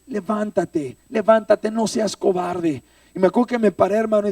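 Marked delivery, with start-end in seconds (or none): 0.1-2.8 raised, insistent retold order; rally someone to get up; firm, pitched-up exhortation; urging command.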